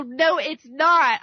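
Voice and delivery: stubborn slow retort in a mild quarrel; firm denial. Retold or imitated. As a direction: plant your feet on each word. Retold back-and-forth argument.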